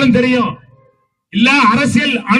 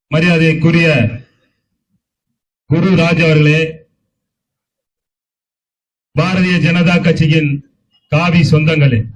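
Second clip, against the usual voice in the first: thunderous political oratory; 9 semitones lower than usual. Acted. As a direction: declaim each name to the crowd. Formal rally address to dignitaries.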